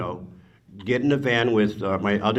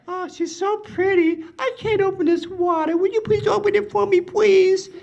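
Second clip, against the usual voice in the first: much higher, sugary mock damsel voice; Sharon Stone imitation. Sharon teasing the narrator's girlfriend by playing the helpless girl, hammed-up high sweetness. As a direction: ham up a helpless girl asking for help.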